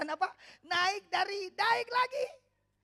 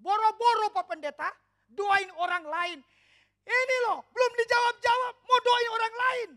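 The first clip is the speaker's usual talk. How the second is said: louder, higher complaining churchgoer; mock complaining; 8 semitones higher, 11 dB louder than usual. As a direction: whine it like a grumbling believer. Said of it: "Kita kan kadang-kadang berkata begini".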